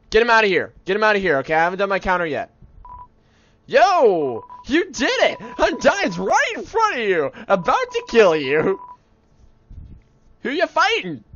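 high, loud, frantic shouting; excited yelling. Acted. Kid cheering on Undyne's fight, excited yelling. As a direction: yell like an overexcited kid at a fight.

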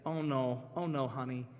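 soft, low dismayed murmur; quietly dreading for a child; wife's worried thought. Retold or imitated. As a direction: think it aloud, hushed and sorry. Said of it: "what she tells me she's thinking".

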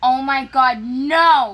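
loud cry of dismay, far above talk level; dismayed shout. Real, not acted. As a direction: shout it out in frustration. Falling at lasers in game.

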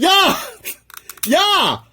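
high, loud sudden yelp; alarmed cry as a part slips; startled yell. Real, not acted. Builder yelps as the arm almost pops off, startled yell. Piece nearly breaks off.

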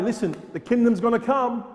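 ordinary delivery

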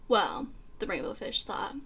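plain reading voice

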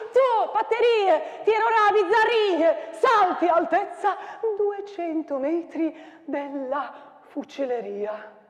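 declaimed with wild swings of pitch and loudness; dramatic poem recital. Acted. Teacher performing the battle poem for students, declaimed.

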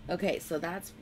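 ordinary talk, nothing marked

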